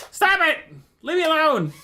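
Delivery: high, loud mock yelps; pestered cat impression. Pilot playing a cat that wants leaving alone, mock indignant yelp. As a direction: cry out like an annoyed cat. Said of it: "Kitty cat impression".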